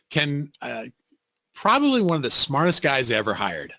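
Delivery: normal talking voice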